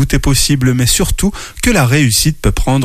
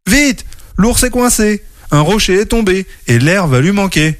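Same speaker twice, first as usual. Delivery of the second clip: calling for help; 7 semitones higher than usual. Acted. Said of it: "appelle au secours de toute voix".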